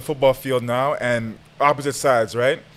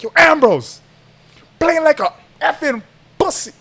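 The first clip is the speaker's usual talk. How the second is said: high, loud mock rebuke; teammate's scolding; 13 semitones higher, 5 dB louder than usual. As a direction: imitate someone yelling at a slacking player. Teammate scolding him, retold.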